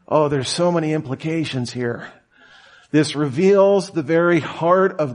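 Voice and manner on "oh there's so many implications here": ordinary delivery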